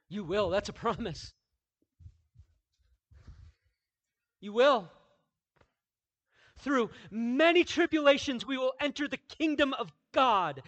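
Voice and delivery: loud, high, ringing pulpit voice; preaching at full force. Acted. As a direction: proclaim it to the whole church.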